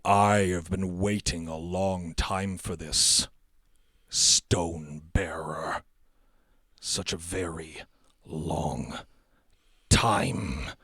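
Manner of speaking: slow, measured words with long pauses; sinister villain. Acted. Thrall revealing himself to the Stone Bearer, sinister slow voice. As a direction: let each phrase hang ominously.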